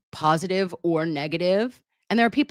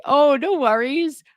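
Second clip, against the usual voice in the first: high sing-song reassurance; 9 semitones higher, 4 dB louder than usual. Real, not acted. Reassuring viewer Amy.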